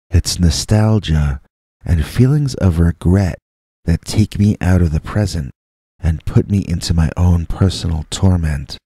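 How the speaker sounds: ordinary delivery, nothing marked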